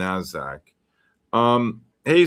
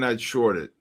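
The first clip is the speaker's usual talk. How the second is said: ordinary talk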